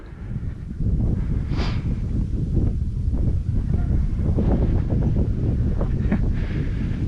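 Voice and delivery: a long, airy laugh at a viewer's joke; real amused laughter; long breathy laugh. Real, not acted. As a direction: laugh it off for a good while.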